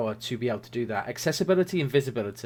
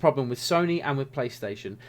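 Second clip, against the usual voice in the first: usual speaking voice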